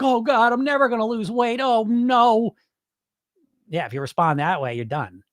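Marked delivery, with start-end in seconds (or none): 0.0-2.5 higher, mock-despairing moan; pitched-up hopeless self-pity; defeated whimper.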